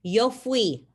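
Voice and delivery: careful Spanish drill voice; model pronunciation for repeating. Shown as a demonstration. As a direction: say "Yo fui" slowly and clearly for the class to echo. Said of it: "I'm gonna say 'em for you".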